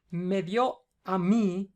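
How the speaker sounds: "Me dio a mí" drawn out slowly, leaning on "mí"; slow example. Shown as a demonstration. Tutor explaining the accent on mí, slow and deliberate. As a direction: dictate "Me dio a mí" at half speed. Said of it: "si tú dices".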